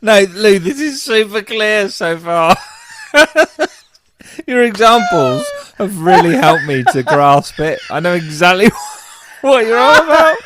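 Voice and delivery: high, loud, laughing through the words; laughing sarcasm. Real, not acted. Teasing her co-host while laughing hard. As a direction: tease her while cracking up.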